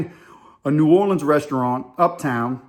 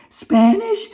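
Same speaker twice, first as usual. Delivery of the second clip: high, loud incredulous question; mock appalled surprise; 5 dB louder than usual. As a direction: echo it high, imitating her shock. Acts woman's shocked reaction.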